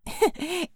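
short harsh laugh. Acted. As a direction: laugh over her. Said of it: "interrupted her with another laugh".

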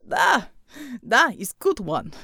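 lightly voiced slow Russian accent; pleased big Russian, taking his time; a hint of a Russian accent; Russian bear. Pavel the Soviet bear speaks.

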